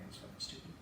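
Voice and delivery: whispered under the breath, no voice; a quiet whispered jab at the movie. Retold or imitated. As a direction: breathe it out as a hushed aside. Repeats the line whispered.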